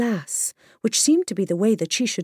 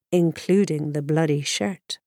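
ordinary delivery

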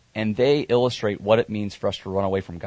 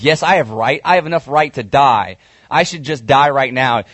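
louder and higher, a preacher acting out Jonah's exasperation; frustrated outburst; 3 semitones higher, 6 dB louder than usual. Jonah protesting to God, loud exasperated complaint. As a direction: push it out like a fed-up prophet demanding to die.

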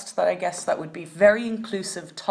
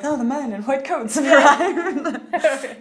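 slow, higher, hammy doom voice; mock dramatic; 6 semitones higher, 5 dB louder, much slower than usual. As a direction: announce it like a spooky ending. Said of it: "every podcast will end with".

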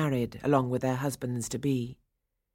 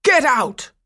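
much louder and higher angry yell; furious shout; 10 semitones higher, 10 dB louder than usual. A narrator's reading. Toymaker shouting Franz out even harder, furious. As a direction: roar him out the door. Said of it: "Coppelius cried".

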